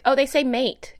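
ordinary talk

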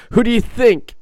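loud, high, incredulous comeback; exasperated retort. Retold or imitated. Nephew answering his teasing uncle, exasperated retort. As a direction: imitate an exasperated relative.

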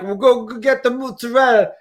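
high-pitched New York Italian-American mimicry; hammed-up Italian-American accent; Terry, New York Italian-American. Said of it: "Terry would be like".

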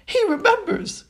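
very high, thrilled squeal; high-pitched, overjoyed exclamation; excited cry. A narrator's reading. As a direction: cry it out in sheer delight. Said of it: "cried D-, Daedalus Diggle".

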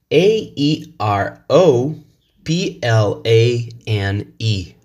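slow letter-by-letter spelling; slow classroom spelling of each letter. Shown as a demonstration. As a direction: spell C O L O R slowly, one letter at a time. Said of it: "In the British English, we say".

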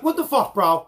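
loud, high mock yell of an angry cop; hammed-up outraged cop.

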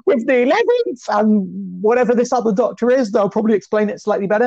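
high-pitched excited fan gushing; freaking out. Retold or imitated. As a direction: re-enact a thrilled freak-out, voice way up high. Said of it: "freaking out and being like".

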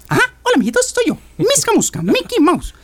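very high squeaky cartoon mouse; Mickey Mouse voice. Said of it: "como Mickey Mouse".

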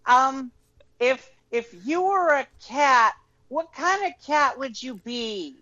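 high, slow game show host; flirty teasing dating host. Pretend. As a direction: tease the bachelor like a dating host. Mock dating game host question.

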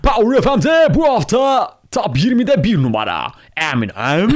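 hyped-up high, loud radio announcer; mock radio DJ. Friend mimicking a radio DJ hyping a chart hit. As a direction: announce it like a Turkish FM DJ. DJ parody again.

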